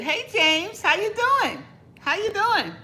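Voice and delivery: high, loud, bright hello across the room; excited greeting. Real, not acted. Vlogger greeting James in the chat, excited loud hello. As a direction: call out a big happy hello.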